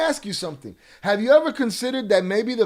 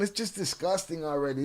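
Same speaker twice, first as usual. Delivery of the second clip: ordinary talk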